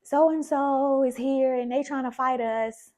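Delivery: retelling mom's angry phone call; mother's irate call. Retold or imitated. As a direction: imitate an upset mom on the phone. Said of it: "And she's like".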